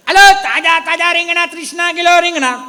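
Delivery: loud, high street hawker cry; vendor calling out. Acted. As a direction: shout your wares to the street. (જોરથી શાકવાળાની બૂમ)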